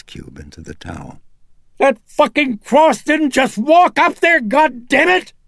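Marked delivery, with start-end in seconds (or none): 1.8-5.3 much higher and louder screamed fury; enraged mother shrieking at the household; furious shriek. Acted. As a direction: scream it at the whole room. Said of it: "Chris shrieked".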